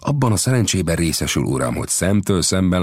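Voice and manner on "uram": plain reading voice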